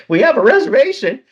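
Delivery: high and loud mock indignation; indignant protest. Retold protest at intercom.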